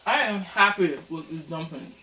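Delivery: chewing while talking; mouth full. Real, not acted. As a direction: talk through a mouthful of dumpling. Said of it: "Sorry, I can talk with my mouth full".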